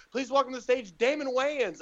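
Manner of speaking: high, projected stage introduction; George Lopez. Retold or imitated. Host bringing the next comic on stage, announcer-style. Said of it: "George Lopez is like".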